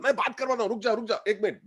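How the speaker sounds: high, hurried promise into the phone; eager fan on phone. Retold or imitated. Fan tells his caller to wait for the actor, eager.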